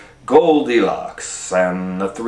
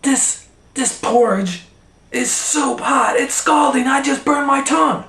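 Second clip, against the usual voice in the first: much higher, louder squawky bear voice; Papa Bear complaining; 3 dB louder than usual. A narrator's reading. Papa Bear burned by porridge, high squawking complaint. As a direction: yelp about the hot porridge. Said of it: "big old Papa Bear said".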